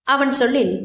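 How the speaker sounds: plain reading voice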